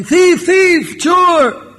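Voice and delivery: loud, very high cry of alarm; villagers' shout. Acted. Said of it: "all villagers came running".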